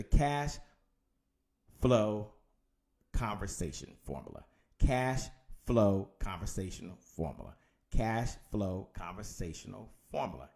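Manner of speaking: measured words with long pauses, falling low. Shown as a demonstration. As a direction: spell it out phrase by phrase for note-takers. Dictating a formula for notes.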